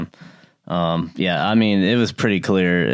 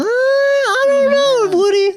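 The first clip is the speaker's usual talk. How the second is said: very high, drawn-out falsetto impression; Tony Romo; 5 dB louder, much slower than usual. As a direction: squeal it slowly and loudly, hamming it up. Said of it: "that's my Tony impression".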